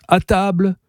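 gentle singsong summons; gentle call. A narrator's reading. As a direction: sweetly call out, pitched well up. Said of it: "dit doucement Marceline".

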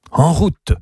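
a quick rallying call; setting off. A narrator's reading. Uncle calls his niece to get going, rallying. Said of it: "il dit quelque chose".

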